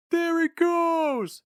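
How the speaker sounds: high, very slow shout from afar; distant cry. A narrator's reading. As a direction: cry out across the field. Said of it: "cried somebody from the other side".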